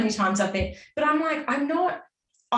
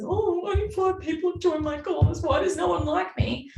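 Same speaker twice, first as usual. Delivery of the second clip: high, mock whiny pity; mock sulking about her empty course; whiny self-pity; 8 semitones higher than usual. A high, hammed-up whine about only five people joining. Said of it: "being in a place of".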